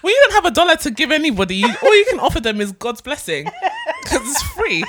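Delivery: laughing high through the words. Real, not acted. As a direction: laugh out the punchline.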